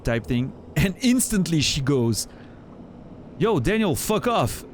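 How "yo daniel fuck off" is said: higher, sharp mock-aggressive "fuck off"; aggressive retort. Friend imitated telling streamer off, mock-aggressive. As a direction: bark it back like a friend who means it jokingly. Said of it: "like, really aggressively".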